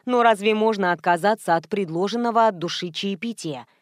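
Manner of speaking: ordinary delivery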